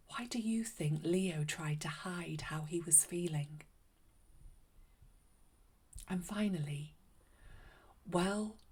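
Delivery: put-on gentle children's host closing the show; soft storytime chat. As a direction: talk gently to the little listener. Host chats after the story.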